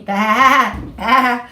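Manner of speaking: loud, laughing bah bah bleat; sheep bleat. Acted.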